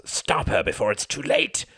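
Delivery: contained scream of warning. A narrator's reading. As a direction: cry out like a devil in his head. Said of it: "screamed one of his many devils".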